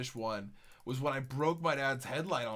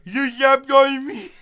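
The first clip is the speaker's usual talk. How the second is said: put-on high, loud, slow baby whine; mock baby voice; 10 semitones higher, 12 dB louder, slower than usual. Mocking what the brother could have said, high baby whine. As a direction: whine it like a hurt toddler. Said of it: "at least he didn't say, like".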